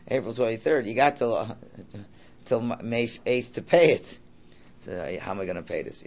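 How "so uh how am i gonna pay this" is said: worried, much softer; quiet worried. Retold or imitated. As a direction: fret it under your breath. Acted fretting over bills.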